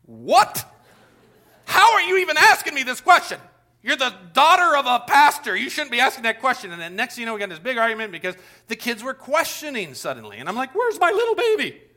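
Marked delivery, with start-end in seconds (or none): 0.1-6.7 louder, higher, incredulous pastor dad; indignant dad. Retold or imitated. Pastor father answering his daughter's doubt, loud and indignant. 10.7-11.8 high, put-on wailing voice; mock lament. Father missing his trusting child, high mock wail.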